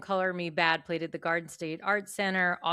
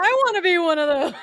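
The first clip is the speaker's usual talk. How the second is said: high, loud starstruck squeal; mock swooning fan voice; gushing kid; 11 semitones higher, 9 dB louder than usual. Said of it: "I was like".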